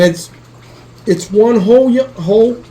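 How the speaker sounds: trips over the words, pitch up; stumbling mid-sentence; a botched first try at the line; fumbled words. Real, not acted. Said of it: "damn it".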